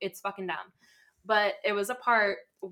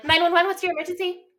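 high, loud dispatcher greeting; perky dispatcher demo; 10 semitones higher, 7 dB louder than usual. Shown as a demonstration. Showing friends how she'd answer calls, high and brisk. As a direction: sing out the emergency greeting, high. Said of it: "You gotta be like".

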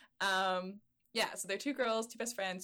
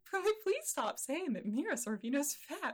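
slow, higher-pitched, drawn-out pleading; exasperated plea; 6 semitones higher, slower than usual. Acted. Host begging people to stop calling the actress fat, exasperated. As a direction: plead with exaggerated, stretched emphasis.

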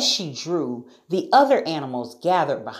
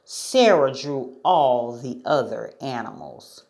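ordinary delivery

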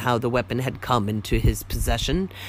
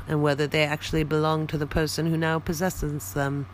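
plain reading voice

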